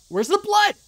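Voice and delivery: very high, loud alarmed cry; startled high yell. Real, not acted. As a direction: blurt it out in sudden alarm. Sudden scare in game.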